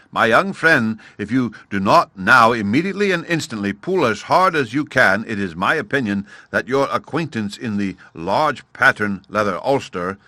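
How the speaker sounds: ordinary delivery, nothing marked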